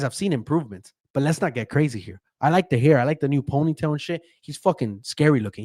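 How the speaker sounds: normal talking voice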